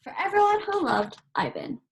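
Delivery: ordinary delivery, nothing marked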